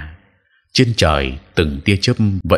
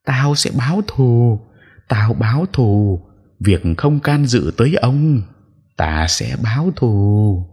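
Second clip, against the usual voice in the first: vengeful ghost; 5 semitones higher, slower than usual. Acted. Ghost vows revenge at exorcist.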